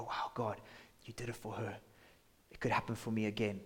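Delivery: softly awed, under the breath; hushed amazed prayer. Pretend. Listener praying quietly to God, soft awe. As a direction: murmur it in quiet wonder. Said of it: "as you listen with faith and you go".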